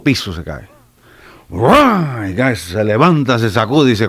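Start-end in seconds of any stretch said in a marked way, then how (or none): 1.5-2.3 loud mimicked thud of a fall; imitated crash noise. Comedian acts out a gallego falling five floors, imitated crash. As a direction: make the noise of a body hitting.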